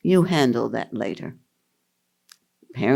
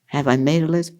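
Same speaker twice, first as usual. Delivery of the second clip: ordinary talk, nothing marked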